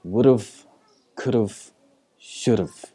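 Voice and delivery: "would've, could've, should've" each run into one word; spaced-out demo of each contracted word; contracted pronunciation. Shown as a demonstration. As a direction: reduce "have" to a short "uhv" ending. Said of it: "Native speakers will often pronounce it as".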